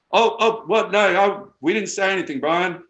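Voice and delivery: high, loud, playfully innocent; mock surprised denial. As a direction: protest your innocence, too loud. Joking denial to host.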